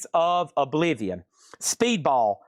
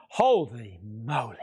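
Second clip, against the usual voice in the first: awed holy moly; 6 semitones lower, slower than usual. Real, not acted. Reacting to crazy bids.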